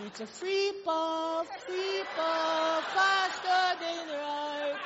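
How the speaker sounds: slow, laughing "free pot" in mock Japanese; mock chef accent. Riffs on the chef's Free Willy, slow and laughing. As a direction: stretch it out, laughing.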